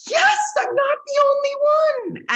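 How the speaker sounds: high, loud celebratory yell; joyful shout. Retold or imitated. Coach learning she is not alone, shouting with joy. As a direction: cheer it out in relief. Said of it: "And I was just like".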